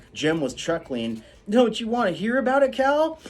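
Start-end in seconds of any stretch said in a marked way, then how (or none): 1.5-3.2 put-on high, loud gleeful boy; teasing Jem. Jem teasing Calpurnia, gleeful. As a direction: tease her, chuckling.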